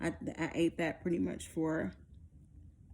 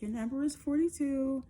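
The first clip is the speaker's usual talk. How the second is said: higher, matter-of-fact imitation; muted nurse delivery; 7 semitones higher than usual. Nurse giving patient her number, understated. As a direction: report it as nurse, without celebrating. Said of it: "her tone and the way she said it".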